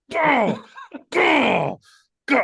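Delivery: high, loud chant of go; egging on the beating; mock cheering shout. Fighter pounding the conductor, with high loud chanting.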